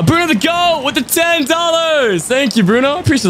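high, bright burst of gratitude; excited thanks. Real, not acted. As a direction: gush a thank-you to the donor. Thanking a donor on stream.

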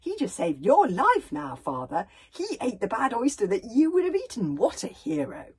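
loud, eager, emphatic praise; gushing admiration. A narrator's reading. Hiccup tells his father the bodyguard saved him, gushing. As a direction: gush loudly, leaning on the big words.

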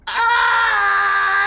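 screaming howl, high and very loud; a pained shriek; anguished howl. Acted. Dingo tastes the poisoned stew, screaming. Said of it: "he howled".

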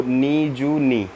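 very slow, spelling out Niju नी for learners; slow demo. Shown as a demonstration. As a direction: stretch Niju नी slowly, syllable by syllable. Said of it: "जैसे हमने यहाँ नीजू पढ़ा".

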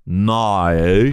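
long, bent Australian "No"; exaggerated Australian twang on one drawn-out word; Australian accent. Shown as a demonstration. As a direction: stretch "No" into several Aussie vowels. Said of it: "Since you're Australian".

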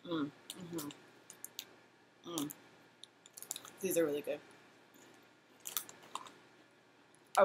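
speaking between bites; chewing while talking. Real, not acted. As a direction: mouth full of cracker. Seen 0: Bites cracker at 0.5, chews visibly until about 3.5s.

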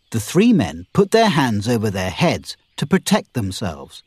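plain reading voice

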